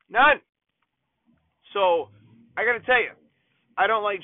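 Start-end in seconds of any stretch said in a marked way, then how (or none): 0.1-0.4 emphatic. Real, not acted. Emphatic repeat, higher and louder.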